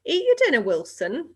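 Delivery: high, loud mother calling out; a mother's high loud nag; nagging mother. A narrator's reading. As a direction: push the boy to eat, loudly. Mother voiced in read story.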